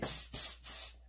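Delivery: sharp hissed "tss" sounds; repeated voiceless "tss, tss"; monkey chatter. A narrator's reading. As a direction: imitate monkeys with short tongue-tip hisses. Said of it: "of course they said".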